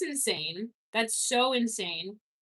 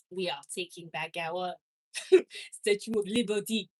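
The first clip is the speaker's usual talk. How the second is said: put-on French accent; 4 semitones lower than usual. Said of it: "There it is, maybe".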